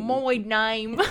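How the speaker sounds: badly faked British vowels; overdone amateur British accent; British accent attempt. Shown as a demonstration. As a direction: try British and miss. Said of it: "I don't think you're going British right now".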